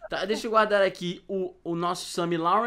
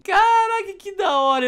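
excited exclamation; 11 semitones higher, 6 dB louder than usual. Real, not acted. Reacting to boss punch.

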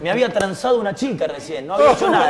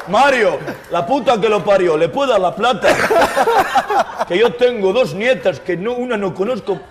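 louder, worked-up retold outburst; exasperated pleading; 3 semitones higher, 7 dB louder than usual. Club owner begging Mario to hand over money, loud exasperated plea. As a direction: swear and beg at full volume, comically desperate.

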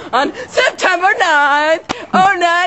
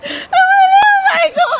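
hammed-up girly squeal; mock squeal. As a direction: shriek the greeting way up high. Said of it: "And Melissa went".

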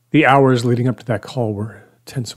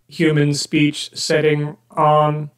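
robot voice; 4 semitones higher, slower than usual. Acted. Joel issues robot command.

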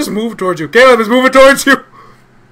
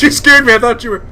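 ordinary talk, nothing marked

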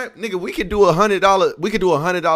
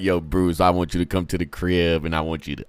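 mock voice message; 11 semitones lower than usual. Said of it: "be like".

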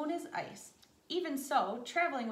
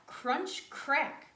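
plain reading voice

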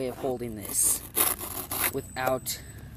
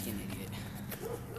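whispered; 5 semitones lower, 12 dB quieter, slower than usual. Real, not acted. Muttered insult aside.

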